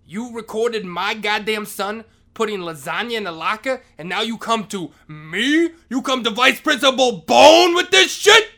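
rising into a shout at the end; hammed-up outraged boss; mock angry vice principal. Vice principal scolding the janitor, rising angry shout. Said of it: "He talks in the third person like that".

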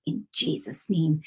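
soft, slow and firm command, fading to a whisper; low-key rebuke that ends in a hushed whisper; quiet authority. Retold or imitated. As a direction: command the spirit to leave without raising your voice. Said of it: "I said it not loud, but I said it with authority".

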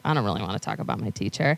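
low, quick reluctant mumble; low-pitched, grudging teen reply; reluctant students. Retold or imitated. Reluctant kids, acted.

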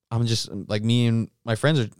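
ordinary talk

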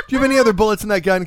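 high, fast words through laughter; laughing. Real, not acted. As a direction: beg through the giggles. Laughing plea to stop teasing.

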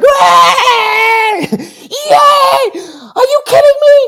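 high, loud, crying-out joy; ecstatic shout. Retold or imitated. Actor relives hearing he got the role, screaming with joy. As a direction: scream it like you just got the part. Retelling getting the role, jumping.

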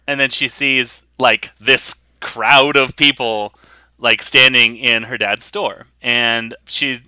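normal talking voice

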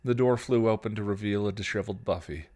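ordinary delivery, nothing marked

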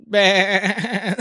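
bleating snore; sheep bleat. Retold or imitated. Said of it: "it sounded like".

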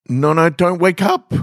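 high, loud pleading; desperate plea. Acted. Dreaming man pleads with fading woman, desperate and high. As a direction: beg the dream not to fade.

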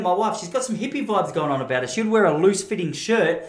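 ordinary delivery

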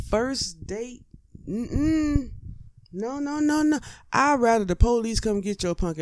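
hammed-up shocked date shaking it off; mock appalled date. Date hearing about bail, high and appalled.

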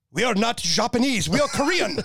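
high-pitched mock outburst as a Korean officer; indignant Korean colonel. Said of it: "this lieutenant colonel of the roc Army".

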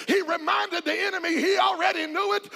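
rousing, driving sermon delivery; full-force preaching. Real, not acted. Preacher urging the church to praise God, full-force preaching. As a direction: preach it out to a congregation.

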